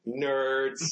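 high, drawn-out playground taunt; teasing jeer in imitation; mock jeer. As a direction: heckle them, stretching the word out. Acts out jeering friends watching Lost.

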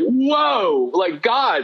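high, stunned whoa easing down on God; awestruck. Retold or imitated. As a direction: overcome with wonder. Second encounter in bedroom.

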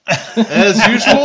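slow laughing self-mockery. Real, not acted. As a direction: laugh it off at yourself. Self-mocking after being wrong.

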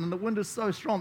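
ordinary talk, nothing marked

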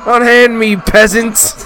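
high, loud snobbish outrage; drunken posh sneer. Acted. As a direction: bellow at the peasants like a drunk lord. Mock posh arrest protest.

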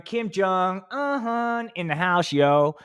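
slow, high hype-man announcement; hype intro. Pretend. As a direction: announce him like a club MC.

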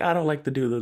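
high, casual mock voice; lightly voiced vampire; mock Spike. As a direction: play it cool as Spike.

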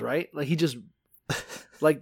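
usual speaking voice

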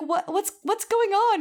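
high, slowed stammer of confusion; mock bewildered panic. Imitating Scorpia confused as everyone votes, high mock bewilderment. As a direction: play a flustered innocent, voice climbing high.

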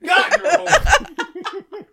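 high loud slow laughing jab; laughing tease. Real, not acted. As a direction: laugh it out at him. Teasing friend, laughing.

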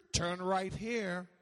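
slow, drawn-out navigation prompt; measured mock GPS announcement. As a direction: imitate a sat-nav giving a direction. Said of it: "there's an audible voice".

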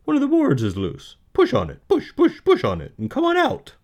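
high, loud, fast goose chatter; quick, loud honking goose voice; fast-talking goose. Acted. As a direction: rattle it off like a goose, repeating words. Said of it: "said the goose".

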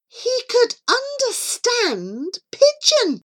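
high, drawn-out amazement; astonished. A narrator's reading. Narrator on Charlie's utter shock at understanding, astonished. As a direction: stretch it out in sheer disbelief.

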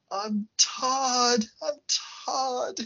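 high insistent mock plea; high-pitched insistent retold plea; imitating Todd. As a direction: plead your own name. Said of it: "Todd's like".

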